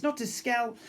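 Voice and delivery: ordinary delivery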